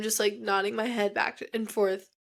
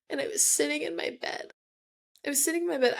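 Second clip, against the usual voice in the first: higher voice, close to tears; real tears rising while remembering; holding back crying; 5 semitones higher, faster than usual. Real, not acted. Patient remembering the day she came home, starting to cry. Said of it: "I hate crying, like, in front of you guys".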